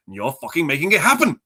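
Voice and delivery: mock pissed off. Villain to his henchman, retold by a fan, pissed off. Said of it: "he's getting pissed off".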